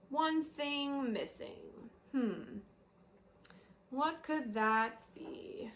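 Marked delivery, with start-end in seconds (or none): none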